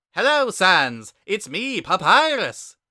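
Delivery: high, loud, booming skeleton brother; loud high cartoon brother voice; Papyrus voice. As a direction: bellow it out like an eager kid brother.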